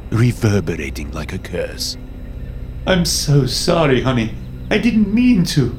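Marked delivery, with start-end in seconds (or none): 2.8-5.7 high, anguished apology; crying out. A narrator's reading. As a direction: cry out for forgiveness, pitch climbing. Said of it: "I cried".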